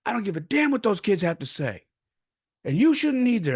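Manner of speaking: high-pitched imitation of an angry scolding; worked-up mock telling-off; grandfather going off. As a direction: lay down the law as a fed-up granddad. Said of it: "he was going off".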